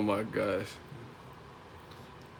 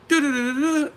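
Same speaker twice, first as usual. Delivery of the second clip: high, loud rapid-fire stutter; imitated high-pitched fight flourish; Batman sound effect; 6 dB louder than usual. Shown as a demonstration. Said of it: "I want you to have some Batman sound effects".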